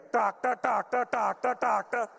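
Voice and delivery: "doctor, doctor" as a rhythmic tick-tock indicator noise; Indian-accented indicator. Retold or imitated. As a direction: chant "doctor, doctor" like a clicking blinker. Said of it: "Why does your indicator have an accent".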